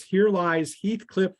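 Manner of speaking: ordinary talk, nothing marked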